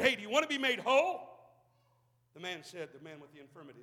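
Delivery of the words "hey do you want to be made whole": louder, the question called out; loud challenge. Acted. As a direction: throw the question at the room. Jesus' question repeated to congregation.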